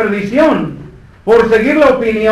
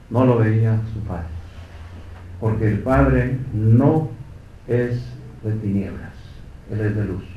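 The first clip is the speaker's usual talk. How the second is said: hushed grave; 9 semitones lower, 8 dB quieter than usual. Real, not acted. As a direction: speak in sorrowful hush. Hushed grave section of sermon.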